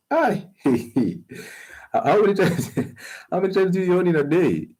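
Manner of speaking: high laughing mock exclamation; laughing disbelief. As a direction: squeal it out through laughter. Mocking yawning listeners.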